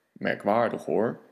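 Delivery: low, deep monster rumble; Gruffalo voice. Acted. Gruffalo sizing up his dinner, low and deep.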